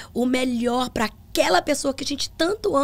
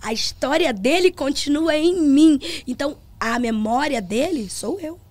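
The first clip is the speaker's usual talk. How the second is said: slow, louder preaching cadence; preaching to the church; 4 dB louder than usual. Retold or imitated. Pastor telling the congregation about her father, slow louder preaching. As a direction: proclaim it slowly from the pulpit. Retells her words from pulpit.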